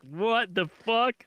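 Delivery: mock stunned crowd; baffled audience. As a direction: let the question hang in confusion. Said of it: "everyone's like".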